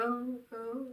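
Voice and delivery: soft fading moan, imitated echo; echoing mock moans. Moans echo out in the game, faint imitation. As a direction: moan softly as if echoing away. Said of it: "it, like, echoes out".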